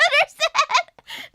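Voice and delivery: high, loud burst of laughter while talking; cackling through the word; helpless laughing. Real, not acted. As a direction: crack up on the word, drawn out.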